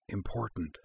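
very soft, hushed aside; hushed reply to a companion; low voice. A narrator's reading. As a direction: murmur it without breaking your attention. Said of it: "replied in a low voice".

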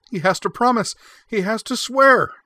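very high, loud insistence; shrill. A narrator's reading. Freya to her brother about Corwin, shrill insistence. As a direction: shriek it in rising panic. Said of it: "her voice becoming shrill".

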